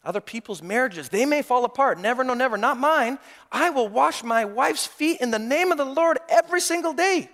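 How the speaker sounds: mocking a smug husband sure his marriage can't fail; smug boast. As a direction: puff up with piety. Mocking self-righteous voice.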